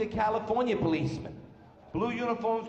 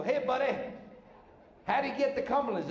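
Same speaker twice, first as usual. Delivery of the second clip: lightly disguised voice, pitched up; higher put-on voice, a thin disguise; changed voice; 7 semitones higher than usual. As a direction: pretend to be a different driver asking directions. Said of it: "so I changed my voice".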